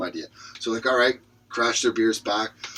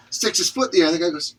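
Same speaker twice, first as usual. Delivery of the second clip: normal talking voice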